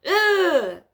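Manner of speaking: loud mock groan of disgust; disgusted ugh. Vlogger imitating the noise upstairs with a loud ugh. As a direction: let out a big fed-up ugh.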